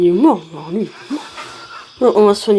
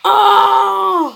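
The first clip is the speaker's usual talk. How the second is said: long, high-pitched scream; acted battle scream; 8 semitones higher, 4 dB louder than usual. Player voices a fighter being hit, acted battle scream. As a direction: scream out in mock pain.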